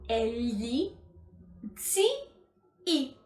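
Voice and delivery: Si, i split into two slow, distinct syllables; separate syllables. Shown as a demonstration. Teacher breaking zii into syllables for students, slow and distinct. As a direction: pull the two i sounds apart clearly. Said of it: "tem que ser muito bem pronunciada".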